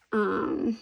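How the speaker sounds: normal talking voice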